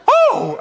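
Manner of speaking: very high, loud "oh"; hammed-up falsetto squeal; old woman's surprised cry. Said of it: "she said".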